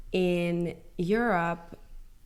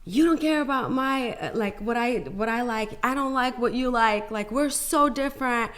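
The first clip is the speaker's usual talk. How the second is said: heated outburst; 5 semitones higher than usual. Retold or imitated. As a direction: throw it at him like you're done. Quarrel with husband, ending marriage.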